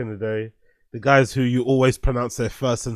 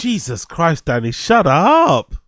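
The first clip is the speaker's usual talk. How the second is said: exasperated reaction; 3 semitones higher, slower than usual. Real, not acted. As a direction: groan it at someone oversharing, stretched at the end. Reacting to an embarrassing clip.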